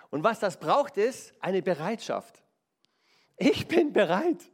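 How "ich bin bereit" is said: lifted, louder vow of readiness; a determined, raised promise; resolute declaration. Acted. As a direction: declare it like a decision made. Preacher voices a resolute declaration.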